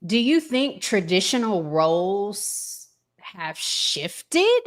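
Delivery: ordinary talk, nothing marked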